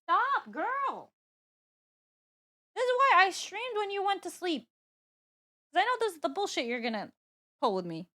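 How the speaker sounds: high, loud exasperated scolding; scolding the cat. Real, not acted. Streamer scolds her cat for wrecking the stream, loud and high. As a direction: yell at the pet to knock it off.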